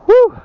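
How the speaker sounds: high loud gasping woo from the climb; winded woo. Real, not acted. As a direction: let out a loud, breathless whoop. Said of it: "I'm out of breath".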